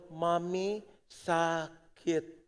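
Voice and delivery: put-on slow, whimpering little child; sick child. Child telling mom she is sick, slow whimper. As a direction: stretch it out like a sick kid. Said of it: "Cuma dengan sebuah seruan".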